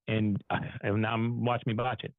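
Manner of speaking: normal talking voice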